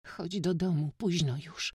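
high pleading, near tears; high tearful plea; pleading, holding back tears. A narrator's reading. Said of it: "prosiła, powstrzymując łzy".

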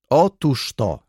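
high, loud exclamation; calling out. A narrator's reading. Baroness seizes on the judge's question, calling out. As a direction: call it out, seizing the point. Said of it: "zawołała baronowa".

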